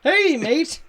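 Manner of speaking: high, loud hammed-up Australian "Hey, mate."; mock Australian accent. Job applicant showing off to interviewer, mock Australian accent. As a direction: toss off a cartoon Australian greeting. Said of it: "I can do this accent".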